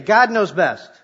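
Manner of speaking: rally the room to shout it. Shown as a demonstration. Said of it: "Say it again".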